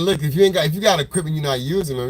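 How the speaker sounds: ordinary talk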